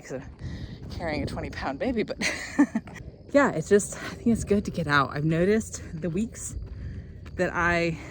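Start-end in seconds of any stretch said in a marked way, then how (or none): none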